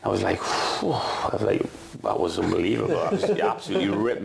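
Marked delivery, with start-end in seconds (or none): 0.4-0.9 breathy high whew of shock; a stunned exhale after the rollicking; whistling exhale. Retold or imitated. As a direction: blow out air, stunned. Reacting to the telling-off.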